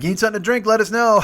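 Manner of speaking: put-on jokey offer from teens; teasing young dudes. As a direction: tease him lightly. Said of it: "and they're like".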